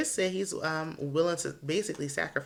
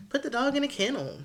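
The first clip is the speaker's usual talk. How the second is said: higher and louder, fed up; frustrated exclamation; exasperated outburst; 9 semitones higher, 5 dB louder than usual. Real, not acted. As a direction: blurt it out like it's obvious. Exasperated advice to couple.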